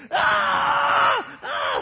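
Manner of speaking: piercing high mock scream; girlish scream. A wimp attacked by his counselor, girlish screaming. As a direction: scream like a third grade girl, full volume. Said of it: "screaming like a third grade girl".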